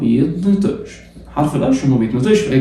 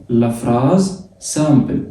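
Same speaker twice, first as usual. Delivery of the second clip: لا فراز سامبل read out very slowly; slow French phrase; much slower than usual. Shown as a demonstration. Teacher reading French phrase slowly for students: very slow. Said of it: "سم ولا سام".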